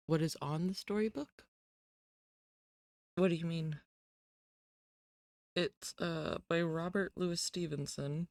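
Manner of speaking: close-miked, right at the mic; right in your ear. Real, not acted. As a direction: lips almost on the mic. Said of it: "get closer to the mic and whisper".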